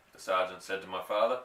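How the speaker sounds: plain reading voice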